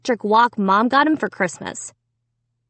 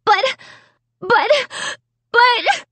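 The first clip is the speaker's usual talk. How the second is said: high, broken-off stammer of outrage; sputtering; 14 semitones higher, slower than usual. A narrator's reading. Sister too outraged at Jed to finish, sputtering. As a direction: sputter it, each try higher. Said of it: "my sister sputtered".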